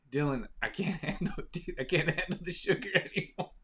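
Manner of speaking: high, laughing through the words; giggling as you beg off the sugar; laughing plea. Retold or imitated. Laughing as she tells Dylan she can't handle sugar.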